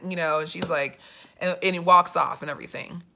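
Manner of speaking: usual speaking voice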